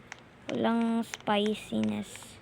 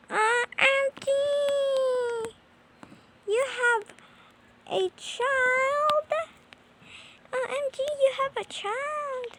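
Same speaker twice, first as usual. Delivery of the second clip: high, slow hammed-up crying; mock wail; 13 semitones higher, much slower than usual. Gamer mock-wailing at the pregnant auntie, hammed-up crying. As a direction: wail as if heartbroken, playfully.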